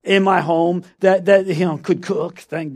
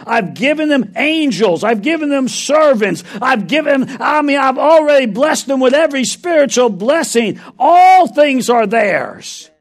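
louder, rising preacher's cry; preaching at full force; 7 semitones higher, 5 dB louder than usual. Acted. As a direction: drive it home to the church.

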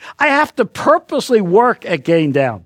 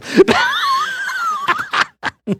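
high, drawn-out laughter; long laugh. Real, not acted. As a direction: keep laughing at the joke. Preacher laughs at own joke.

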